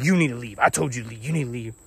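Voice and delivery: retold snappy ordering-out, lightly voiced; mild mock scolding; mock snapping. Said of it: "she's mad and snapping on me".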